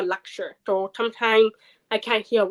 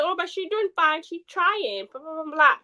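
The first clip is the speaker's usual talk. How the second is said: mocking teacher; 7 semitones higher than usual. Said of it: "and then he said".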